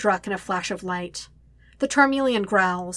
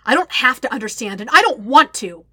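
loud, higher, heated retort; snapping back; 4 semitones higher, 9 dB louder than usual. A narrator's reading. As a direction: snap back angrily, hitting it hard. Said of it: "Zuko snaps back".

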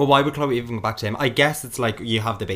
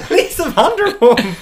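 laughing reply; 12 semitones higher, 7 dB louder, slower than usual. Real, not acted. Laughing, answering.

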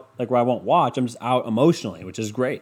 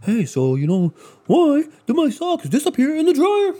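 high, loud hacky comedian voice; hammed-up, high-pitched joke setup; bad stand-up comic; 13 semitones higher, 4 dB louder than usual. Mocking a bad stand-up joke opener, high and loud. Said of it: "start of a bad stand-up joke".

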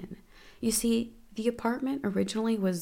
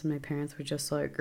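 usual speaking voice

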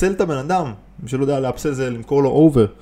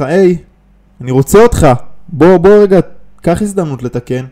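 loud customer plea; 4 semitones higher, 9 dB louder than usual. Retold or imitated. Voicing complaining customer.

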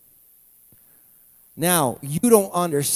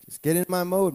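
usual speaking voice

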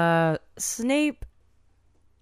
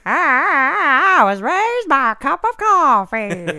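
high, loud, slow dopey cartoon voice; slow goofy cartoon drawl pitched up high; Homestar impression; 9 semitones higher, 10 dB louder, slower than usual. Imitating Cornbread but landing on a Homestar voice, high and loud. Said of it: "I end up doing Homestar from Homestar Runner".

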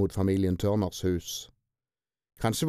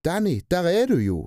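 high, louder cry of joy and fear; glad and scared; 7 semitones higher, 4 dB louder than usual. A narrator's reading. Tina glad and scared on finding Danny. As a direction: burst out, relieved yet afraid. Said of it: "Glad og redd på samme tid".

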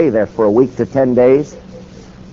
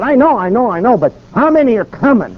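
exasperated; 9 semitones higher than usual. Retold or imitated. Pressing churches for bus numbers.

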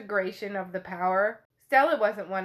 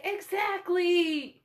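drawn-out, higher "Exactly!" in mock delight; eager exclamation; 7 semitones higher, much slower than usual. [name] gleefully agreeing with Cortland, drawn-out excited exclamation. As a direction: stretch it slowly, delighted.

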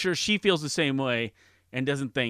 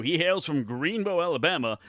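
ordinary talk

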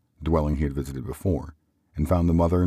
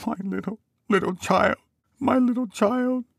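very high wail, growing louder; breaking down; 4 dB louder than usual. A narrator's reading. Father mourning his little child, breaking down. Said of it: "He broke down all at once".

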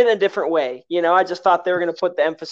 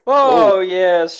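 ordinary delivery

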